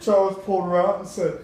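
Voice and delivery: ordinary talk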